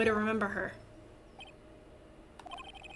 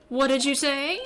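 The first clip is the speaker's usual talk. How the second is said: emphatic, louder rising question; pouncing question; 4 dB louder than usual. Acted. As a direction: pounce on the witness's slip. Lawyer pounces on slip, second take.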